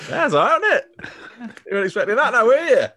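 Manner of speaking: a cheery northern English manager, hammed up high; mock Mick McCarthy. Host hamming up a high cheery manager voice for friends. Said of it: "Tone up the misery a little bit".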